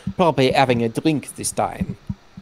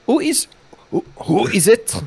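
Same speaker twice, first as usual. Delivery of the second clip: put-on vague German accent; 8 semitones higher than usual. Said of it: "I'm just gonna go vaguely German".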